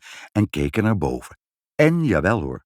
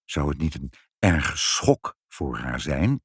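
slow, lower, wavering question; hesitant; 5 semitones lower, 3 dB quieter, slower than usual. A narrator's reading. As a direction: doubt it gently, taking your time. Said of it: "zei vader weifelend".

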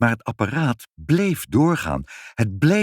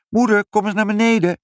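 high, loud, quick call; calling upstairs; 13 semitones higher, 5 dB louder, faster than usual. A narrator's reading. Father calls mother down to the kitchen, calling out. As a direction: holler for someone upstairs.

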